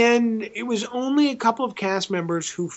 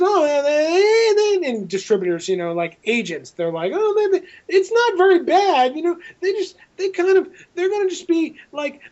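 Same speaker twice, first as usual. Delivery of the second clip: higher, halting, tripping over every word; stumbling over words; 10 semitones higher than usual. Retold or imitated. A nervous agent stammering to the director, higher and halting. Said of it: "he was stumbling over his words".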